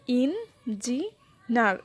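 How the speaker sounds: ইঞ্জিনার very slowly in three separate syllables, landing on নার; stress on last syllable. Shown as a demonstration. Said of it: "আমরা বেশি stress দিছি".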